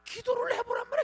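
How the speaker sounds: high, loud cry of urging; urgent shout. Acted. Pastor crying out to the congregation to pray, loud and high.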